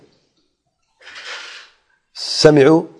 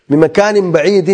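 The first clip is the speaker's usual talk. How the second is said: slow, raised chanting of the phrase; slow Quranic chant; recited verse; 5 semitones higher than usual. Acted. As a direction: draw the Arabic out like recitation.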